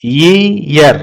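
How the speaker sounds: "ईयर" split slowly into yee, yar; correct pronunciation. Shown as a demonstration. Teacher repeating the right reading of "ईयर", yee-yar in two syllables. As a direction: sound out "ईयर" with an opening y, in two parts. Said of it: "ईयर पढ़ते हैं".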